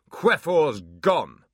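loud, very slow demon shout. Acted. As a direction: spit the words out in rage. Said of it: "shouted Abbott".

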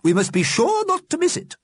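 very high, emphatic character voice; keen Missus Liberty. A narrator's reading. Missus Liberty urges the dead to watch, keen.

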